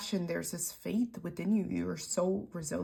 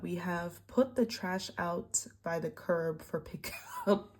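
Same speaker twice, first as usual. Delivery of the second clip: usual speaking voice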